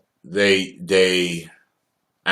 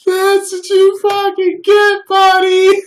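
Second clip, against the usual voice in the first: very high, loud, slow gloating shout; Ashley Miller voice; 7 dB louder, slower than usual. Retold or imitated. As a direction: gloat at the crashed driver like a Canadian skater girl. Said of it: "exactly what Ashley Miller talks like".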